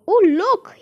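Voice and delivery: high, loud exclamation; excited cry. A narrator's reading. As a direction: cry out to draw their eyes. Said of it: "he cried".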